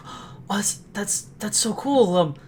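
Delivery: quietly thrilled, settling as it goes; acted excitement. Young performer marvels at a surprise reward, quietly excited. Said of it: "I get very excited".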